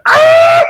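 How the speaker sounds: sudden high piercing yelp; scream. Retold or imitated. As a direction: shriek out in alarm. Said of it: "Sassy was just there going".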